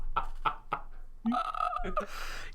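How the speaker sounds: long soft laugh up high; laughing at the Sonic joke; high breathy laugh. Real, not acted.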